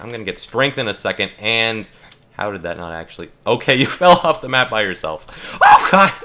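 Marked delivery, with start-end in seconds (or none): 5.6-6.1 high, loud startled cry; genuinely startled in the game; panicked yelp. Real, not acted. Gameplay panic.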